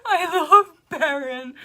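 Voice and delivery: teary, laughing through tears, high and loud; overwhelmed fan crying and laughing; happy sobbing, voice breaking; crying with joy. Real, not acted. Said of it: "I wanna cry so bad".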